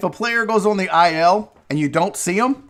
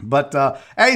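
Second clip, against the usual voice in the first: normal talking voice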